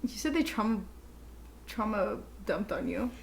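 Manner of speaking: ordinary talk, nothing marked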